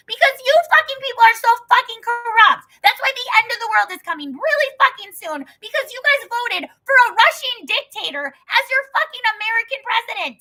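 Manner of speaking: high and loud, yelling at the country; furious shout. Real, not acted. Raging at American voters, furious shouting. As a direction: blast America with outrage.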